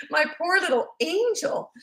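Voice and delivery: laughing through it, high and slow; mock sweetness, cracking up; sarcastic laugh. Mocking her lying son.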